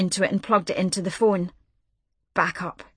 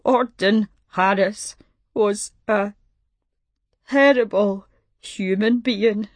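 slow, broken tearful words; crying hard; slower than usual. A narrator's reading. Caller dictating his own obituary, slow and tearful. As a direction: force it out through tears. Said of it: "He was crying hard now".

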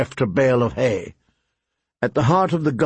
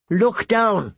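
higher, firmer repeat of "Look down."; "Look down." said higher and more pressing, like a bird insisting; insistent crow; 8 semitones higher than usual. A narrator's reading. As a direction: crow repeating its order to the frightened boy, higher.

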